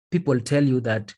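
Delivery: ordinary talk, nothing marked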